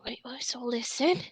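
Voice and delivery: quiet, sly street informant; a furtive alley voice sizing you up; hushed shady informant; suspicious informant. Acted.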